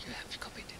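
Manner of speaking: a quick private whisper, not for the audience; real whisper to a helper about the file; whispered aside. Real, not acted. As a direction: whisper to a colleague off-mic. Aside to helper, tech trouble.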